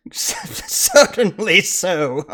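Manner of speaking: put-on high, slow accented drawl; fake foreign accent. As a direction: stretch it out in a foreign lilt. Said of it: "You have the strangest".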